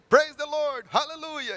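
high, loud cry of praise; joyful hallelujah yelled high and loud; exuberant praise shout. Retold or imitated. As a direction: shout it out like an overjoyed believer. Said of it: "he would say".

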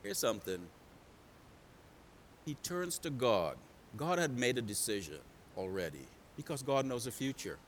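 low, soft, grave sermon delivery; quiet, solemn preacher; hushed preaching. Real, not acted. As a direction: bring it right down, almost confiding.

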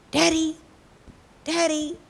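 short high calls; anxious child calling. Acted. Girl waking to check on her sleeping father, anxious. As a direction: call out for Daddy on waking.

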